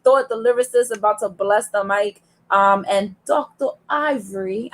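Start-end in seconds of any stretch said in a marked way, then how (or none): 3.2-4.7 light touch of fake British; half-hearted British imitation; mock British accent. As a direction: say the name with a slight put-on London accent. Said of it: "I have to say it like that".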